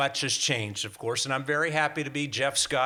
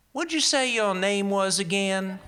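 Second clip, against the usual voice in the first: put-on Southern accent; 8 semitones higher, 5 dB louder than usual. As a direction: ask it like a suspicious Southern shopkeeper. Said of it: "who had a very heavy Southern accent".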